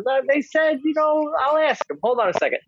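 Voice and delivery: dad's voice pitched high and vague; a lost old dad stalling for time; high mumbly dad. Retold or imitated. Dad stalling his son on the hospital phone, high and vague. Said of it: "He's like".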